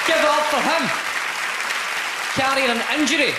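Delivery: loud, high announcer shout; host shout. Real, not acted. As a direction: bring the crowd up for the comedian. Host introducing comedian.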